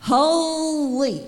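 a single drawn-out holy, full voice; angels crying out. Retold or imitated. Preacher voicing angels crying out around God's throne, full voice. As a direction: call it out like an angel circling the throne. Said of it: "in a loud voice with all they've got".

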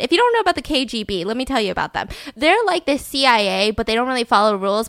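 ordinary talk

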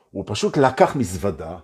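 ordinary talk

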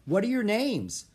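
high-pitched, curious call; girl calling. A narrator's reading. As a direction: call the question ahead to runners. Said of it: "the girl called".

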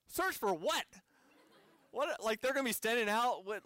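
high-pitched incredulous question; alarmed disbelief. Retold or imitated. Visitor reacting to news the area was searched, incredulous.

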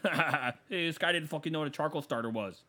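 laughing-stock call-out, mocking; mock jeering. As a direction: announce his ignorance to the whole party. Imitates friend jeering to others.

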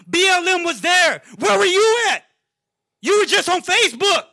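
loud, high and fired up; heated outburst. Real, not acted. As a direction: call them out hard, voice rising. Heated podcast rant calling out.

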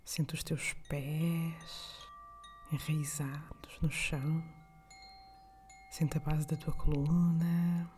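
calm guide with long pauses between breaths; guided meditation. Acted. (meditação guiada, voz suave e lenta)

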